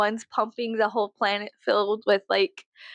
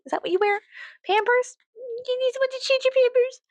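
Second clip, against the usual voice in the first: mock baby taunt.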